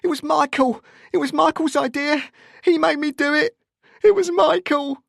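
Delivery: loud, very high hysterical wail; screamed pleading. A narrator's reading. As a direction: scream your innocence to your mother in panic. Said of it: "he continued to scream".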